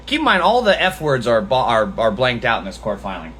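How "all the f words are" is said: normal talking voice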